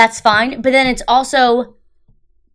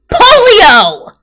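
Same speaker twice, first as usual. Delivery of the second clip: imitated scream; 10 semitones higher, 10 dB louder than usual. Retelling a comedian's vaccine bit, building to an imitated scream. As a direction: build from emphatic setup to a high, loud shout on the last word. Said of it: "she screams it at the audience".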